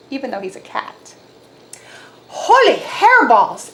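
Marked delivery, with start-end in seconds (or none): 2.3-3.7 high, slow, surprised cry; exclaimed. A narrator's reading. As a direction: shout it in cartoon shock. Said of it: "exclaimed Skippy John Jones".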